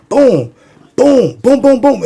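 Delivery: high, punchy imitated booms; explosion sounds. Teller imitating the lights exploding floor by floor, punchy booms. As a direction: mimic each light blowing up.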